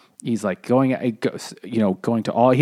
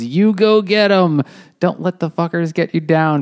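cheering send-off; 6 semitones higher, 4 dB louder than usual. Retold or imitated. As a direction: cheer him on out the door. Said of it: "everybody cheers and goes".

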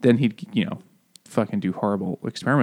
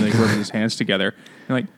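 ordinary talk

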